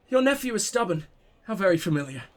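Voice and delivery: normal talking voice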